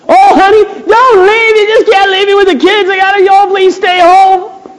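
high, loud hammed-up begging; wife pleading with her husband to stay home; mock pleading wife. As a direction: beg your husband not to go.

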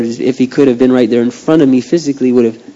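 usual speaking voice

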